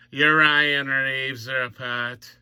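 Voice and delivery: slow, stretched-out title call; mock segment announcer. Host repeating his Ryan Reaves segment title, mock announcing. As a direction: draw each word out like a hammed-up announcer.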